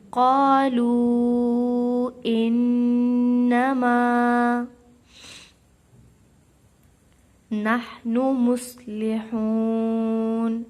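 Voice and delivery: slow, loud melodic tajweed chant; Quran recitation. Acted. Teacher recites for students in slow chant. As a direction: draw every syllable out like a reciter.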